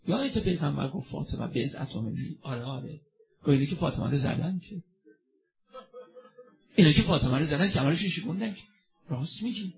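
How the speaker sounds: hushed gossip. Acted. Worshippers secretly murmuring to each other in the mosque, hushed. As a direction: murmur it like neighbours muttering in the mosque rows.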